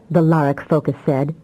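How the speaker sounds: usual speaking voice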